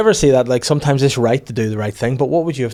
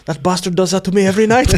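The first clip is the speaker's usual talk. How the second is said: high, loud mock complaint; aggrieved van man; 8 semitones higher, 5 dB louder than usual. Chip van man complains about the shaker, aggrieved. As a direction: imitate a fed-up victim, voice raised.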